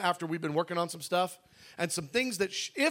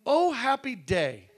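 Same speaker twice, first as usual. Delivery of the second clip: slow, slightly high sing-song; a touch of cheerful bag voice; 6 semitones higher, 4 dB louder, slower than usual. Retold or imitated. Bag note greeting him, lightly voiced cheerful. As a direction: read the bag's note with mock cheer. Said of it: "And this bag says".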